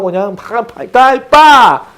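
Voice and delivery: sounds that build from slow to sharp and loud; mock smiting noises. Preacher mimicking God's punishment, building to a loud burst. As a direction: mime a thunderbolt landing with rising pa-pa-ppa. Preacher imitates God striking down.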